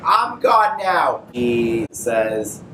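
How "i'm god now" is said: much higher and slow, savoring it; mock triumphant proclamation. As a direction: draw it out like a gloating new god. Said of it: "she's like".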